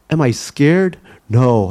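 ordinary delivery, nothing marked

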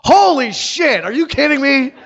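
high, loud disbelief tumbling into a rush; mock astonished excitement. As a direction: imitate a stranger flabbergasted and thrilled. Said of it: "She was excited".

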